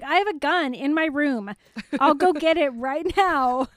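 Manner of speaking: lightly voiced snarky teen; Scott Evil impression. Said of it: "like Scott Evil in Austin Powers".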